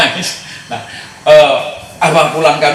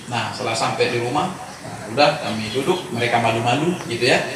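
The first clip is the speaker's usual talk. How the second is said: normal talking voice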